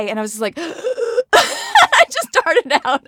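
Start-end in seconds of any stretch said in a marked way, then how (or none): 0.6-3.0 high gasp breaking into laughter. Real, not acted. Fan recalling meeting Adam Sandler, gasping laughter. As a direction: gasp and laugh at once, starstruck.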